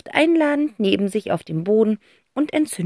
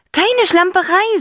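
put-on boy voice defensive; 12 semitones higher, 6 dB louder than usual. Zack insists he wasn't messy.

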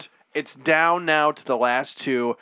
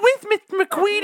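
put-on eager kid voice; 4 dB louder than usual.

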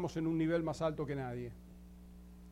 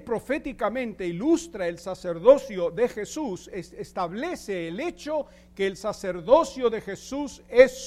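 preaching; 3 semitones higher, 8 dB louder than usual. Acted. Pastor preaching to the congregation about priesthood, emphatic and loud. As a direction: drive each point home from the pulpit. Preaching doctrine with rising force.

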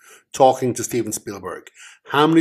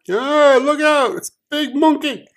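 hammed-up warning cry; 5 dB louder than usual. Hammed-up King Kong movie quote.

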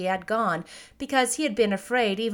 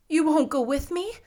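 put-on mildly pleading little girl, higher pitch; 6 semitones higher than usual. A narrator's reading. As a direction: ask with a touch of entreaty. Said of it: "pleaded the girl".

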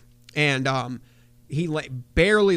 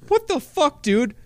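much higher, louder disbelief; incredulous outburst; 13 semitones higher, 4 dB louder than usual. Retold or imitated. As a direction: react to something insane. Narrator's incredulous reaction.

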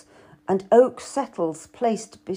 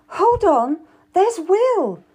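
calling out louder, higher; crying out; 10 semitones higher, 6 dB louder, slower than usual. A narrator's reading. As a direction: call out to get his attention. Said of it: "I cried out to George".

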